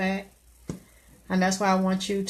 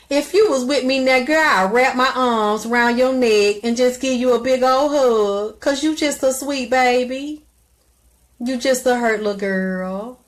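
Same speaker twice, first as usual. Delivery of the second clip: tender comfort; 5 semitones higher, 4 dB louder than usual. Real, not acted. Comforting a hurting caller.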